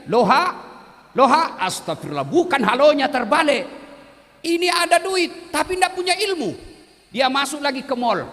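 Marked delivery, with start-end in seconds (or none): 0.1-6.5 loud exasperated villager on the phone; confused shouting. Retold or imitated. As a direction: holler it, flustered and loud.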